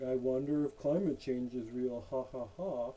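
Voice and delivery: lightly mocks a smug parent who scoffs; mocking the parents. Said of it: "they're like".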